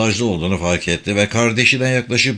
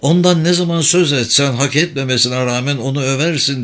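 bitter; 6 semitones higher than usual. A narrator's reading. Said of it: "dedi Kadri acı acı".

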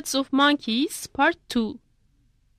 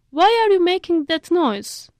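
louder curious question; 6 semitones higher, 5 dB louder than usual. A narrator's reading. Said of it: "asked the monkey".

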